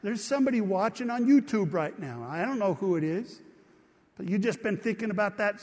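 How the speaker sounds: usual speaking voice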